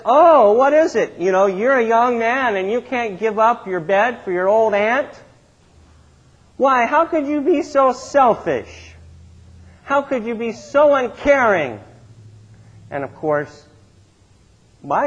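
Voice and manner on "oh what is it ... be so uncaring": loud, high-pitched scolding tirade; scolding aunt. Retold or imitated. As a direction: lay into the boy like an indignant aunt. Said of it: "she laid into me".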